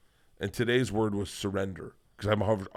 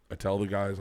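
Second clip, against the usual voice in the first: ordinary talk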